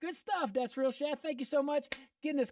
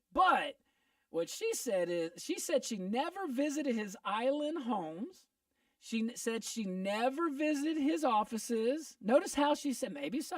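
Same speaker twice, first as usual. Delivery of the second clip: normal talking voice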